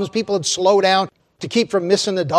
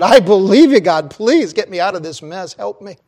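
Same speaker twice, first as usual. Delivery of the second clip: pleading from the branch, louder; desperate pleading; 3 semitones higher, 7 dB louder than usual. Acted. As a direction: beg to get out of the mess.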